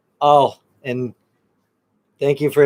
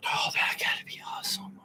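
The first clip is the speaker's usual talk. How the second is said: softer whispered reaction; a soft awed whisper; 14 dB quieter than usual. Real, not acted. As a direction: breathe it out in a whisper. Reacting to grandmom's Nottingham accent.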